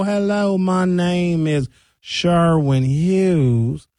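very slow, drawn-out Southern drawl; slowed-down Southern accent. Shown as a demonstration. Showing how slowed English becomes Southern, Southern accent. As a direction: stretch the English accent out until it turns Southern. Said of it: "And if I just slow".

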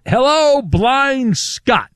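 big high announcer hello; radio host hyping the caller; hyped greeting. Real, not acted. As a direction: welcome the caller like a star.

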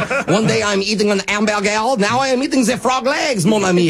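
hammed-up cartoonish French accent. Prank caller showing how the French flu comes and goes, French accent. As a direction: lurch into a broad mock French accent. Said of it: "now your voice is normal".

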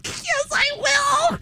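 very high, soft giggly squeal; imitating a helpless fit of giggles; giddy. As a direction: squeak it out, choking back laughter. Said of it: "why you sound so giddy".

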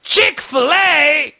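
put-on slow, high, loud British accent, drawn out; bad British accent. British fan excited about Chick-fil-A, slow hammed-up British accent. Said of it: "My accents are awful".

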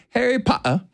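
high mock-British, muted T's in "Potter"; British accent, glottal stop in "Potter". Shown as a demonstration. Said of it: "they kind of mute it out".

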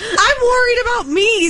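high, slow whine; plaintive protest. Real, not acted. Co-host worried about her colleague, plaintive protest. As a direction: plead it, drawn out and high.

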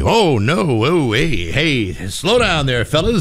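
drawn-out, sing-song nervous pleading; Mike Rattay uncomfortable. Retold or imitated. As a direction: imitate an uneasy guy trying to slow his pals down. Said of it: "And Mike would say".

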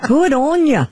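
laughing Aussie "Good on ya"; hammed-up Australian, with a laugh; Australian accent. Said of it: "do 'em in an Australian accent".

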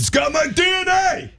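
high, far shout as Rocky. Retold or imitated. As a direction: yell it like Rocky. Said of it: "Christine likes your accent".

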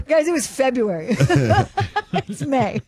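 amused, breaking into laughter; laughing protest. Real, not acted. Room mom admitting how recent it was, laughing at herself. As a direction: say it through laughs at yourself. Laughing at her own forgetfulness.

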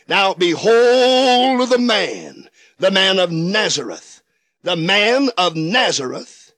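ordinary delivery

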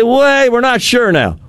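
high-pitched mock uncertainty; mock backtracking. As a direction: imitate officials suddenly walking it back. Officials' backtracking quoted mockingly.

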